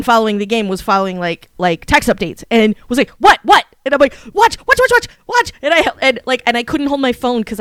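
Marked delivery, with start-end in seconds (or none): 3.2-3.6 high, loud double take; startled demand. Retold or imitated. Friend following text updates hears the news, startled. 4.3-5.5 high, rapid-fire repetition; frantic urging. Retold or imitated. Fan showing a friend the shot, frantic.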